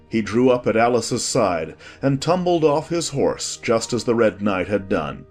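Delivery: plain reading voice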